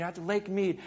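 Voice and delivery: normal talking voice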